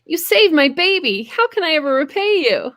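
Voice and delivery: high, dramatic thanks; hammed-up overjoyed mother; gushing gratitude. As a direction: gush at your rescuer. Wife thanks the raptor.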